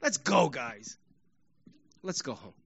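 low, soft and defeated; dejected. Acted. Others a week later heading home, dejected. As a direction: give up quietly, voice sinking away. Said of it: "And others would say".

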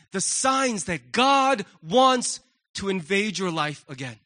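ordinary talk

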